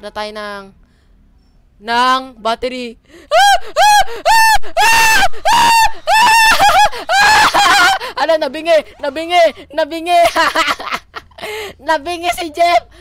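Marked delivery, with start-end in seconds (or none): 3.3-7.9 piercing high shrieks in a row; string of loud shrieks; repeated screaming. Real, not acted. Screaming at the pursuing killer. 8.2-12.9 high, loud, breaking into laughter; delighted shouting between laughs; laughing glee. Real, not acted. Gloating that screams deafened Jeff.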